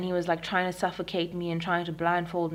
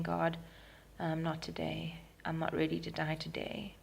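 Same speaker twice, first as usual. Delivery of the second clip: softly recalled prayer; retold prayer; 8 dB quieter than usual. Woman praying to God while fighting, softly retold. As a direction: murmur a remembered plea, softly. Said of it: "I remember praying".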